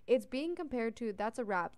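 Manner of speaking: ordinary talk, nothing marked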